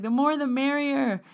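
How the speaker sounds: high, lilting retold voice; welcoming reassurance. Bree welcoming her to join in, high reassuring voice. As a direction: sing out the welcome, pitched high.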